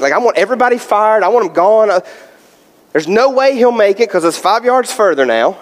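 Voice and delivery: ordinary delivery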